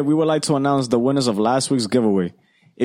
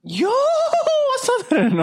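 very high, slow sarcastic greeting; mock high greeting; slower than usual. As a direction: stretch it out in a silly high voice. Greets DoorDash mockingly.

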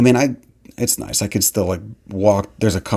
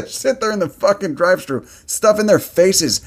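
pitched-up scornful rant; disgusted mockery; 8 semitones higher than usual. Real, not acted. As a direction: sneer it out with contempt. Mocking drive-thru customers.

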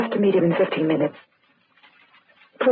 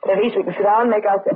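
usual speaking voice

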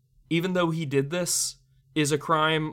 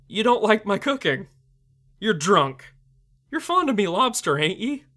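higher, louder, half-crying plea, building to a shrill shout on the last words; hammed-up pleading; 6 semitones higher, 6 dB louder than usual. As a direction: beg like a hurt old sailor whose cooking was snubbed. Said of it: "pleading with him. He's almost crying".

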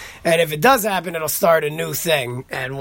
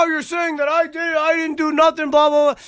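imitating a flustered scammer in a much higher voice; mock the caller's panicky denial, pitched way up; freaking out; 12 semitones higher than usual. Phone scammer denying it to the caller, imitated much higher and panicky. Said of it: "And then he started freaking out".

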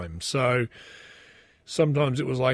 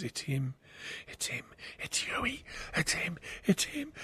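excited whisper; 3 semitones higher, 13 dB quieter than usual. Retold or imitated. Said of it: "my wife goes".

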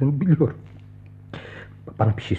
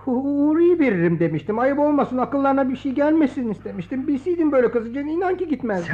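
much higher, pleading and fretful; high anxious excuses; 8 semitones higher than usual. Acted. Guilty excuses to scolding friend. (tiz, kaygılı özür)

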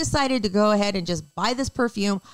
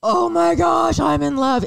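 excited; 8 semitones higher, 3 dB louder than usual. Real, not acted. Raving about the tortillas.

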